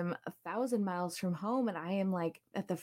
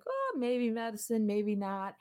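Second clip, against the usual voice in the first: wishy-washy therapist; 5 semitones higher than usual. Retold or imitated. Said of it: "he was kind of like".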